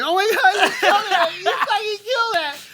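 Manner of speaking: very high mock-weepy squeal; imitated crying. Sister overwhelmed after the set, high mock crying. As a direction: wail the praise in a squeaky falsetto. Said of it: "and my sister's crying like".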